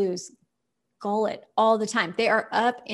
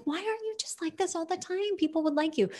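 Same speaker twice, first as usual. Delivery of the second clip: owners pleading with cat; 8 semitones higher, 6 dB quieter, faster than usual. Retold or imitated. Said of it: "we're like".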